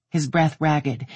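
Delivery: ordinary delivery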